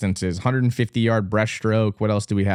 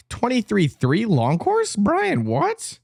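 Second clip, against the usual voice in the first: disbelief; 11 semitones higher, slower than usual. Real, not acted. Shocked at swimmer's time.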